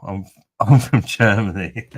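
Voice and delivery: laughs through a fake German origin; laughing joke answer. Joking to chat about being from Germany, laughing.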